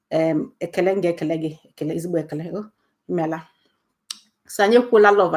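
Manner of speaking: normal talking voice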